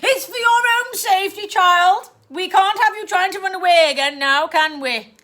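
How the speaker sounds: sweetly cruel; aunt locking her niece away; falsely sweet aunt. Acted.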